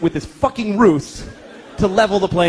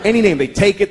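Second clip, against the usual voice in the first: ordinary talk, nothing marked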